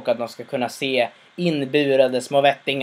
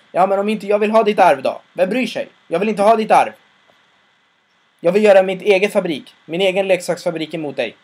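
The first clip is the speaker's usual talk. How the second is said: higher, louder defiant declaration; defiant son; 5 semitones higher, 5 dB louder than usual. Retold or imitated. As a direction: stand up to your father. Son defies his father.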